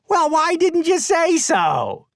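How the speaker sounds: very high, slow squeaky knocker voice; a tiny magical knocker exclaims in delight; exclaiming door knocker. A narrator's reading. Door knocker answering the dragons, very high and squeaky. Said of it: "the knocker exclaimed".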